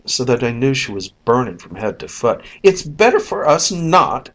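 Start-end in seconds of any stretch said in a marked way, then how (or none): none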